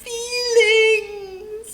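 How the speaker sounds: high, loud, slow crying wail. A narrator's reading. Storyteller voicing Rudy as the bully wails. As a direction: sob it out like a big baby. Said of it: "and wailed".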